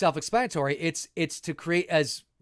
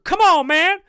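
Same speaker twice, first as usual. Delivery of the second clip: high, loud put-on yell into a phone; hammed-up shouting caller; comic bit of a furious man on the phone; angry phone guy; 12 semitones higher, 10 dB louder than usual. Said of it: "the one where I'm on the phone".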